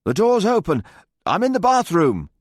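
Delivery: loud, high call from another room; shouting. A narrator's reading. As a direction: shout it through the door. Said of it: "a muffled voice shouted".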